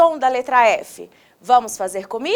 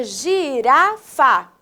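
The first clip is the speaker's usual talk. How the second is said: syllable by syllable; much slower than usual. Shown as a demonstration. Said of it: "vamos falar agora pausadamente".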